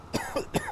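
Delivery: dry cough from dust in the throat; short throat-clearing cough. Real, not acted. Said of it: "I got dust in my throat".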